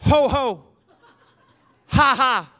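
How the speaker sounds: imitating an intense old preacher; mock-fervent call to repeat after me; fervent prayer leader. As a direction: punch out each syllable pair, loud, with long gaps. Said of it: "with great intensity and great fervor".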